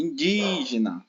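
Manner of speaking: indígena with a long, drawn-out DI; indígena said slowly, leaning on "di"; stress on DI. Shown as a demonstration. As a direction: stretch the second syllable of indígena. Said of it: "a di é a mais forte".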